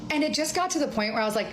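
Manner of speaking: ordinary talk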